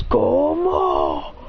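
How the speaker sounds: creature calling its name; Pokemon cry. Acted. Kommo-o announcing itself as it comes out, a creature cry. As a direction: cry out like a battle monster. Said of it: "he goes".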